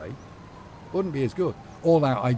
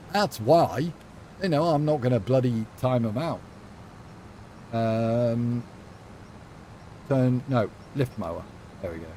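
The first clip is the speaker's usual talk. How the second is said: ordinary talk, nothing marked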